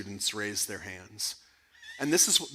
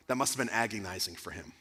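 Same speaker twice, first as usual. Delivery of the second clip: ordinary delivery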